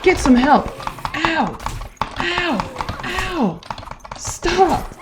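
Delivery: high pained pleading yells; angry crying. Real, not acted. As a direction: beg it to stop, hurting. Dog keeps biting.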